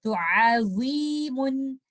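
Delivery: अज़ीमुन drawn out slowly, each sound clear; slow recitation. Shown as a demonstration. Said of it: "ये कुछ चीजें हैं". (धीमी तिलावत में अज़ीमुन)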